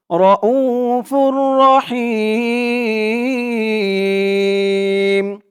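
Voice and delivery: very slow, melodic chant of Rahim; long held vowels in a recitation tune; Quran recitation. Shown as a demonstration. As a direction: recite র উ ফুর রহিম as a full tajweed chant. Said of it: "ফা দিয়ে এই তাসদিদ ওলা র ধরবে".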